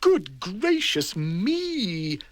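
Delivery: drawn-out dismay; fussy appalled gasp; slow prim shock. Acted. Mister Tidy shocked.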